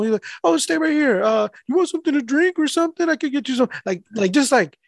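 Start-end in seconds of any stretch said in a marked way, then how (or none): 0.5-3.7 high-pitched, polite imitation; imitated customer. Mail carrier imitating customer offering him a drink, high and polite. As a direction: offer it eagerly in a raised voice.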